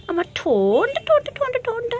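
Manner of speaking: very high, squeaky coo to a pet; high-pitched pet talk, partly sped up. Real, not acted. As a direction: coo at the bird in a tiny high voice. Cooing to her pet bird.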